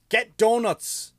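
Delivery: loud, slow, high rant shout; mock shout. Comedian ranting at Dunkin' Donuts, loud slow shout. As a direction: bellow it at the donut shop. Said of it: "ranting at Dunkin' Donuts".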